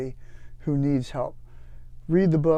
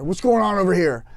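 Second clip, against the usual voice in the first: louder, higher barked question; gruff gunny challenge; 5 semitones higher, 6 dB louder than usual. Retold or imitated. Gunny catching the marines loading grenades, barking demand. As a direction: call out like a suspicious gunny.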